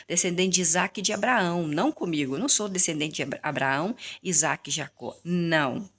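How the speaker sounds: ordinary talk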